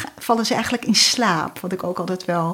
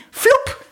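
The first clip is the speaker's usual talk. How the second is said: high whooshing pop; magic whoosh; 4 dB louder than usual. A narrator's reading. Storyteller makes the sausage fly to the nose, high whooshing pop. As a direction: zap it like a magic spell.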